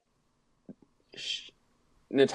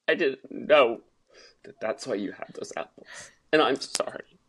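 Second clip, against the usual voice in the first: 1 acted apology. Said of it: "That was really good acting".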